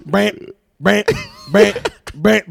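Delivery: sung reggae guitar strums; guitar mimic. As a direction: strum it with your voice.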